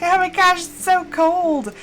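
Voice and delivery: high, dragged-out mock whine about the cold; lightly lampoon a chilly local. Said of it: "Everyone there is just like".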